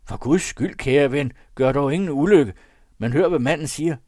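high, loud appeal to the gunman; råbende. A narrator's reading. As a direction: cry out to stop a killing. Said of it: "råbte til skytten". (højt, bønfaldende råb)

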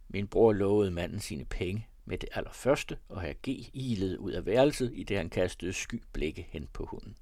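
ordinary delivery, nothing marked

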